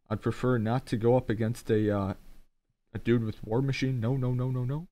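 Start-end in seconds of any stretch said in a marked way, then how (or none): none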